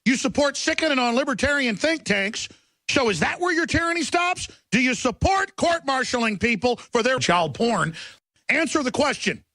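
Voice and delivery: high, pressing interrogation; host grilling a caller on air; badgering demand. Real, not acted. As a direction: corner the caller and demand an answer.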